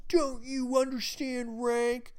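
high-pitched, drawn-out mock yell. Specialist pulling rank on new privates, mock yelling. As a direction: imitate an indignant rank-puller, stretched and high. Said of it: "comes up, starts yelling at us".

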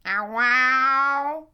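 loud imitated cat yowl. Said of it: "going like".

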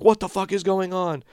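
high-pitched worried outcry, not a scream; high, mock-frantic question; imitated alarmed girls. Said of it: "they're not screaming, but they're, they're like".

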